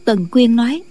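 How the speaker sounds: plain reading voice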